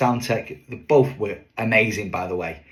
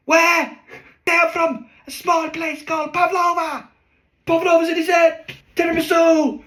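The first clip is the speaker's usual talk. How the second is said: shouting over no mic; 7 dB louder than usual. Retold or imitated. Partners shout the accent bit at a silent audience. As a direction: bellow the bit with no microphone. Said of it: "when you're shouting it".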